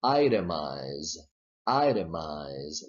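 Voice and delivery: "itemize" slowly with a clear t; itemize model. Shown as a demonstration. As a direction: model "itemize" slow and low. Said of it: "the next one is".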